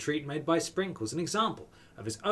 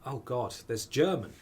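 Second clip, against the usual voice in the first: usual speaking voice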